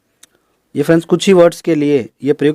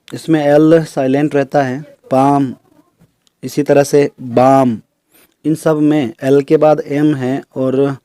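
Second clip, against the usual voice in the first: ordinary delivery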